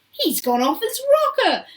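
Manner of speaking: high, loud cry of alarm; aghast shout. A narrator's reading. A father about Wonka to the others, aghast shout. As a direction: shout it out, appalled. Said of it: "shouted one of the fathers aghast".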